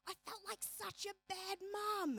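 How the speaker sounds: soft, high guilty sigh; mock guilt. Mum confessing to girlfriends, soft guilty tone. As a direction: confess it softly, ashamed. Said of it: "And she says".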